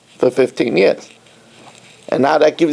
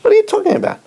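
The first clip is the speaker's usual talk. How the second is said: much higher, astonished question; incredulous outburst; much faster than usual. Real, not acted. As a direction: throw it back in disbelief. Outraged rhetorical question.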